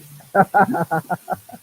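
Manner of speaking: loud burst of laughter; hearty laugh. Real, not acted. Coach laughing after his scholarship joke. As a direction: laugh at your own punchline.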